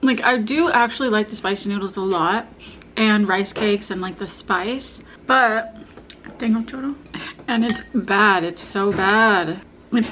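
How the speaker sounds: chewing between words; muffled eating speech; mouth full of food. Real, not acted. Seen 1: ate rice cake just before; lips pressed, chewing between words.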